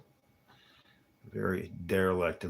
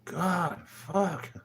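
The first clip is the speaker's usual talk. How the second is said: high, louder fed-up cursing; mock exasperation; 11 semitones higher, 5 dB louder than usual. Fed up with the pestering talker, high, loud cursing. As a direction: curse in hammed-up frustration.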